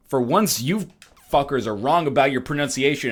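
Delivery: normal talking voice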